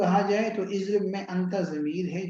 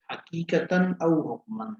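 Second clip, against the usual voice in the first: slow recitation; slower than usual. Shown as a demonstration. Teacher recites Arabic definition slowly.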